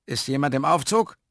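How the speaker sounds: a hint of an official checking in; lightly voiced caretaker. A narrator's reading.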